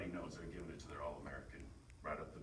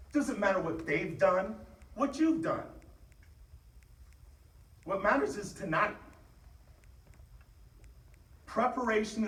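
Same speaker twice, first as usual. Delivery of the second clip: loud, high, fired-up shouting; halftime pep speech; 14 dB louder than usual. Real, not acted. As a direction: fire up the team at full force.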